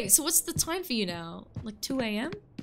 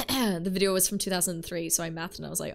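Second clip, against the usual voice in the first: ordinary talk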